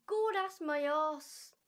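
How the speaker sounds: slow, loud Irish put-down; Irish retort. Acted. The Irish friend brushes off the fiver, slow and loud. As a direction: drag it out dismissively in Irish. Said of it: "So, English, Irish thing".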